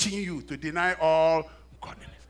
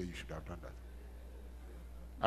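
slow, much lower and softer, near whisper; inner voice; 9 semitones lower, 14 dB quieter, much slower than usual. Retold or imitated. The Spirit nudging him about helping, slow low hush. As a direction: murmur it like a conscience inside. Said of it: "the Spirit of God will say".

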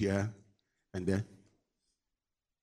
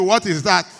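louder, higher mock question; baffled Google; 4 semitones higher, 8 dB louder than usual. Preacher voices Google asking about an unknown town, mock baffled. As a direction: ask it loudly as a stumped search engine. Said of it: "Google will be asking you".

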